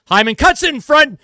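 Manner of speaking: rising play-by-play excitement; excited call. Acted. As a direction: surge as he cuts in front. Chance in front call.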